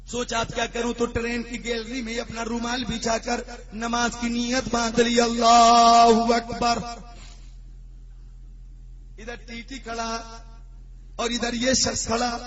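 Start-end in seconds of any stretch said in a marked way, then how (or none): 5.2-6.8 slow, loud call of Allahu Akbar; long sung-out Allahu Akbar; drawn-out takbir. Retold or imitated. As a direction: stretch it out like a prayer call. Mock namaz to dodge ticket checker.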